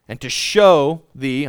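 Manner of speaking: "show" lido mais alto e devagar; stress on show. Shown as a demonstration. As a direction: pesa a palavra "show", mais forte e lento. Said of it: "underline show".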